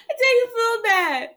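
laugh-cry it out, helpless with giggles. Real, not acted. Host laughing at her own guilt over the old lady, laughing.

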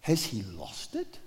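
a crowd's quiet inner question; hushed doubt. Acted. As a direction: murmur it as a thought kept to yourself. Thinking to themselves.

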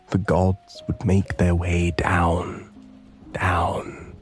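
plain reading voice

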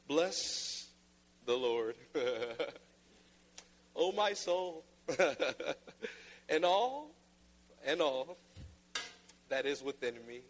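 mock a dull preacher dragging the verse; sleepy, flat parody of a reading with no life in it; bored monotone. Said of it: "but in this tone of voice".

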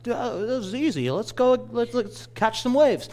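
high-pitched imitation of a surfer son; son's voice. Son eager to catch waves with Dad, imitated high son's voice. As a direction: put on your son's voice, way up high. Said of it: "'cause that's how my son talks".

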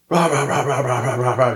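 high rapid-fire rah-rah mock yelling; imitated yelling. Driver retells being pulled over by an officer, mock yelling. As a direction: mimic a ranting cop with high nonsense syllables. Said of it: "just yelling, yelling, yelling".

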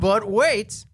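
high, loud hammed-up TV pitchman; booming pitchman. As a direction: belt it like a TV salesman. Shouted infomercial catchphrase.

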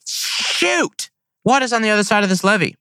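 sharp high "Shoot!" then quick high question; exasperated outburst; frustrated shoot. Retold or imitated. Birds vanish over the levee, high quick frustrated cry. Turkeys dip off behind levee.

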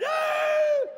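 very high, loud, drawn-out cheer; celebrating dad. Retold or imitated. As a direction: imitate an over-the-top goal celebration. Voicing dad celebrating like pro player.

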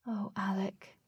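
breathy disappointed whisper. A narrator's reading. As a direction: whisper his name, let down. Katie sighs at foolish Alec.

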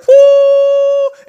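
very high, loud "woo"; sudden soaring whoop for lights coming on; imitated light-up whoosh. Storyteller imitating the tree lighting up, high whoop.

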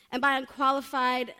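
ordinary talk

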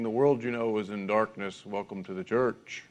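low, soft, gloomy preacher intoning doom; somber preacher. Retold or imitated. Preacher greeting a festival-goer, mock somber. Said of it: "the preacher somberly saying".

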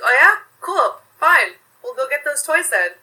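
a son retelling his mom's sarcastic "fine, let's go". Retold or imitated. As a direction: retell mom calling the kids' bluff with fake enthusiasm. Mom calls kids' bluff in story.

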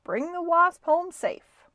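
high, loud send-off; hollering. A narrator's reading. Friend sending Stuart off with the boat, hollering. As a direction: holler it high across the water. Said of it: "hollered his friend".

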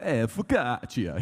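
slow, drawn-out mock Italian; playful Italian-accented exclamation. Shown as a demonstration. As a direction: ham up an Italian accent, stretching the word. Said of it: "do it in my Italian".

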